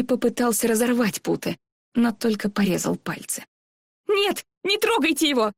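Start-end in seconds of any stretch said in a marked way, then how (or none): 4.1-5.5 crying out. Acted. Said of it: "закричала Эри".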